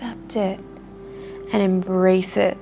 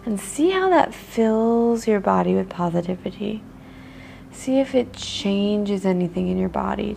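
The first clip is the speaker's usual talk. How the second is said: guided meditation; 4 dB quieter than usual. Acted. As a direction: ease the listener into noticing their body. Leads a body-scan meditation.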